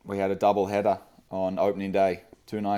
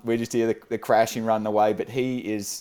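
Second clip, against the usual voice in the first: usual speaking voice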